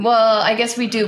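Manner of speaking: usual speaking voice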